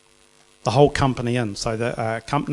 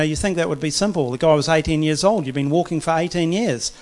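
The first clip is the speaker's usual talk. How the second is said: ordinary talk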